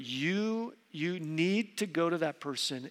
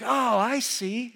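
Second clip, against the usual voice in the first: drawn-out, higher "Oh, I see"; sudden understanding, stretched out and pitched up; dawning realization; 6 semitones higher, 4 dB louder, slower than usual. Acted. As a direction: act out the penny dropping. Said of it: "So it's kinda like".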